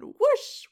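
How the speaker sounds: loud, high whoosh sound; explosive whoosh. Retold or imitated. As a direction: burst out with a whoosh, imitating a blow-up. Imitating an emotional blow-up.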